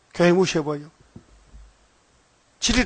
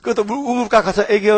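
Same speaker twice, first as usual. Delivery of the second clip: usual speaking voice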